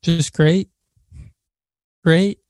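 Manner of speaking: dry, unconvincing insistence that all is well; hint of sarcasm. Real, not acted. Co-host tells friends his lonely week is great, mildly sarcastic. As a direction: say it so nobody quite buys it. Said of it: "I pick up a hint of sarcasm".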